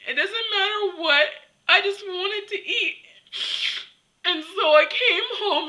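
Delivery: tearful, high voice; real crying. Real, not acted. Telling viewers about last night's eating, tearful. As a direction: plead through tears. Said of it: "Okay, I'm gonna cry".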